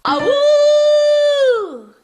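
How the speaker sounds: long high loud falling howl; coyote howl. Shown as a demonstration. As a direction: belt a full coyote howl. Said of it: "like, a coyote".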